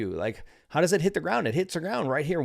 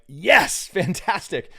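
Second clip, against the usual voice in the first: mock excited; 4 semitones higher, 5 dB louder, much slower than usual. Retold excited reply to wife.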